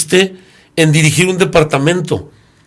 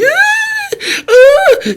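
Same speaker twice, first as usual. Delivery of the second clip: sharp, very high wailing cries, then louder; mock wailing sobs; 5 dB louder than usual. A humble woman wailing in the basilica, high mock sobbing cries. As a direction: wail like a desperate woman crying at the altar. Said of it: "está llori y llori".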